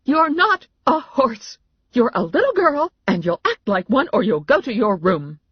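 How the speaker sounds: loud, high-pitched telling-off; furious parental shout; mother yelling. A narrator's reading. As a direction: scold a misbehaving child at full voice. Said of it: "my mother yelled at Mary Alice".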